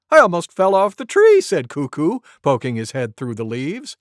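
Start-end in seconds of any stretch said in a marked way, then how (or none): 0.1-1.4 loud, fast startled cry; startled complaint. Acted. Cuckoo complains to Monkey, loud and fast. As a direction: Cuckoo shaken by the noise. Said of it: "That was loud".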